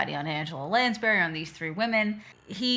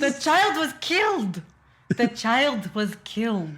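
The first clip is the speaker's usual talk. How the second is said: higher, slow, grave insistence; mock dying old woman; 5 semitones higher, 3 dB louder, slower than usual. Podcaster hams up the old lady's dying words. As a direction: drag it out like a dying prophecy.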